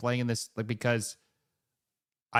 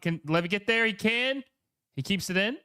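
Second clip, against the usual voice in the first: rising, higher call as the ball is kept in; excited; 8 semitones higher than usual. Real, not acted. Streamer calling the match live, excited rising call. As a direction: lift up with real excitement as he saves it.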